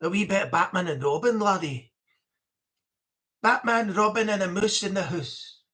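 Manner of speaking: lightly voiced Scottish speaker; a touch of Scots; mock Scottish offer; Scottish accent. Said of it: "someone would say".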